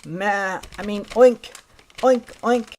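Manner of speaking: high bleat corrected to loud oinks; little pig. Acted. Pig slips into goat sound, self-correcting piglet. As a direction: catch yourself, then oink like a piglet. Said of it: "Then little pig glimpsed".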